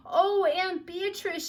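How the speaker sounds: very high, slow, loud cry of wonder; breathless delight. A narrator's reading. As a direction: gasp it out in awe. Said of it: "she exclaimed".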